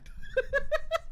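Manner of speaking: high, loud cackling laugh; burst of laughter. Real, not acted. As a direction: crack up at the memory. Laughing at dad story.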